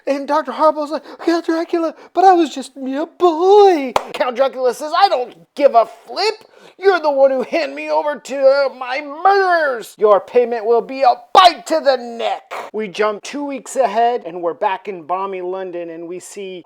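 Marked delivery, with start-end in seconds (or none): 1.2-3.9 high-pitched comic plea; mock pleading. Doctor excusing himself to Count Dracula, mock pleading. 4.9-12.5 raised, punchy comic menace; hammed-up threat. Dracula naming his revenge to the doctor, hammed-up threat.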